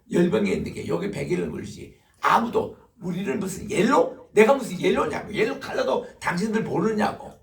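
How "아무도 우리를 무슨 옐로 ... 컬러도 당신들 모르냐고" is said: loud, higher indignant rebuke; retold scolding. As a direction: throw the questions back at them in anger. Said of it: "막 야단을 치니까요". (옐로라는 말에 분개한 큰 소리 호통)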